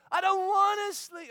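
high, loud whine; child refusing to sleep; whiny kid. Retold or imitated. Daughter whining.

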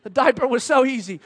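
mock-exasperated dad. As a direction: whine about it like a fed-up parent. Exasperated parent's thought, retold.

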